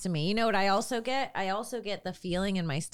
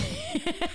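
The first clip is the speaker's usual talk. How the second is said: high squealing laugh. Real, not acted. Bursts out laughing.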